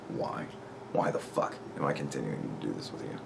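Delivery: low, whispery, given-up murmur; weary exasperation. Real, not acted. Vlogger asking himself why he keeps arguing, weary whisper. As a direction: whisper it as if all patience is gone. Giving up on pointless argument.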